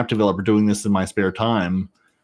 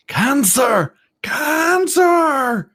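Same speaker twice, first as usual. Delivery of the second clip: shrieked high and loud, climbing higher the second time; mock scream; 5 dB louder, much slower than usual. Quoting a raging player, screamed high and loud. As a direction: wail it like a furious gamer. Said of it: "is what he was screaming".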